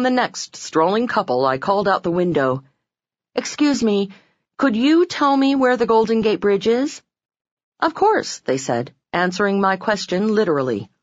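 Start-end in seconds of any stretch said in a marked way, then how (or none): 3.3-7.0 calling out. Retold or imitated. Calling out car window. 7.8-8.4 cheery of course. Retold or imitated. Couple answering brightly.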